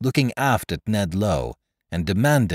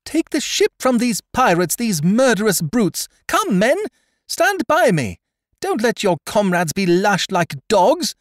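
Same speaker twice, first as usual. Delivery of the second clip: very high, loud desperate cry; a girl begging the crew to stop the flogging; wild cry; 7 dB louder than usual. A narrator's reading. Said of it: "she cried wildly enough".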